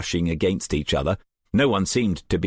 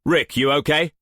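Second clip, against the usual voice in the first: raised, higher call; 7 semitones higher than usual. A narrator's reading. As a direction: call to someone across the room. Calling across the battle room.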